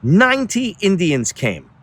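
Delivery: plain reading voice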